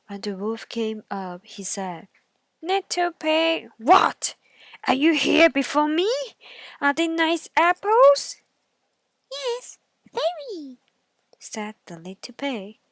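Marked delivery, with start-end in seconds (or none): 2.6-8.4 loud, higher surprised wolf; wolf caught off guard, loud; wolf finding the pig first; startled wolf. Acted. 9.3-10.8 high squeaky pig voice; eager little pig; piggy asks, much higher. Acted. Pig in the tree.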